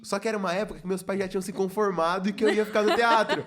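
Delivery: loud, high, laughing through the words; laughing while telling. Real, not acted. Laughing as he tells friends his parents had accepted it. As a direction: laugh it out as you remember.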